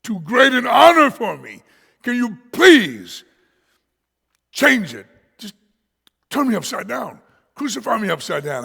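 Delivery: loud, high pleading plea; half-joking loud plea as Peter; pleading shout. Retold or imitated. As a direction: beg loudly to be turned upside down. Peter pleading to be crucified differently.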